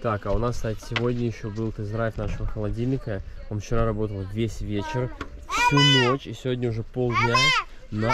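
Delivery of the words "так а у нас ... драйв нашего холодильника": usual speaking voice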